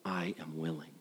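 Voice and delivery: very soft, tender I am willing; almost breathed reassurance; hushed, loving promise. Retold or imitated. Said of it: "Jesus says these words".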